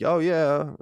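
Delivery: put-on a quick "oh, yeah" in a Boston accent. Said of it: "that was a Boston accent".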